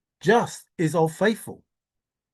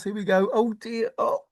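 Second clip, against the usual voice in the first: ordinary talk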